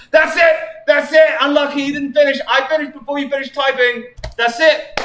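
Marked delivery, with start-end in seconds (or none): 0.1-3.3 high, loud victory yell; triumphant shout. Real, not acted. Streamer finishing the last fanfic, shouting in triumph. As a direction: yell it out, you finally finished.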